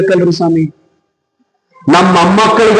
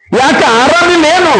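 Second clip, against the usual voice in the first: sadhu's concerned question; 10 semitones higher than usual. Acted. Sadhu voiced in a story.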